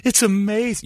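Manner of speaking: high, mock-awed gushing; hammed-up fan swooning over the show. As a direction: gush like a starstruck fan.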